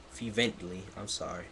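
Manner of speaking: usual speaking voice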